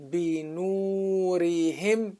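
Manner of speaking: slow, measured teacher's recitation; Quran recitation. Shown as a demonstration. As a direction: draw out the long vowel as in recitation.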